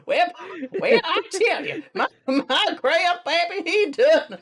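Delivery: hillbilly impression. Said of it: "hillbilly impression".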